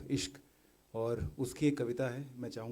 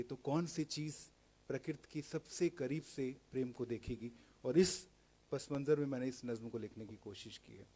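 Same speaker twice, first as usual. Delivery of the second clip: usual speaking voice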